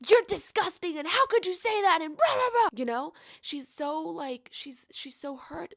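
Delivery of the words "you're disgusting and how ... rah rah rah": high, loud angry yelling; angry outburst. Pretend. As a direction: lash out at him in disgust. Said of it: "but it's not like".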